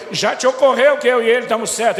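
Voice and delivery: exasperated. Retold or imitated. Father arguing with son.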